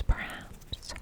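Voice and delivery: ordinary delivery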